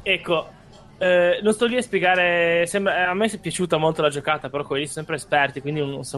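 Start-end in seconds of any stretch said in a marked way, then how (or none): none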